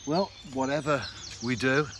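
normal talking voice

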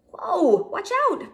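startled warning. A narrator's reading. Cub warns of danger.

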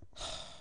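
long, soft breathy sigh; deflated sigh. Real, not acted. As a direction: sigh out the defeat. Just lost after misclick.

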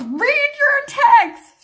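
loud, high, slow mock holler; imitated yelling. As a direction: yell it like an exasperated husband, stretching the words. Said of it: "I hear my husband screaming".

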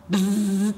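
buzzing buzzer noise; wrong answer buzzer. Pretend. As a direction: buzz like a game show buzzer. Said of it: "make a buzzer sound like this".

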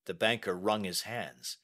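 ordinary delivery, nothing marked

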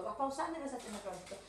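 tossed off quickly; fast, trailing repeat. Shown as a demonstration. Repeat faster and softer.

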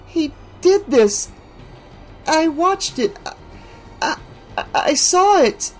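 high, dazed, faltering ramble; lost in the horror, repeating herself; rambling in shock. A narrator's reading. Alice rambling as Rick leads her out. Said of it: "rambled on and on".

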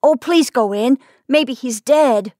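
lightly voiced imploring child; pleading child. A narrator's reading. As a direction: beg them with a touch of worry. Boy begs them to enter.

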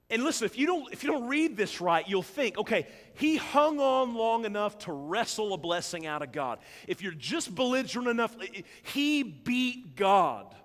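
loud, rising preacher's build; preaching at full force. Acted. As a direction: drive it home to the congregation.

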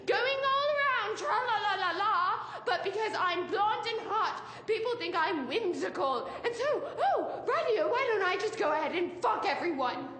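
high, hammed-up mock British accent; Kat, mocking British accent. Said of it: "this awesome fucking British accent. It's like".

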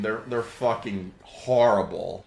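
ordinary talk, nothing marked

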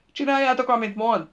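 loud, high shouted order; crying out. A narrator's reading. The king orders everyone to obey the captor, crying out. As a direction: shout it out to all your men. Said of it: "kiáltott fel a király".

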